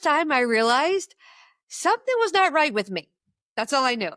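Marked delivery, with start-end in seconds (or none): none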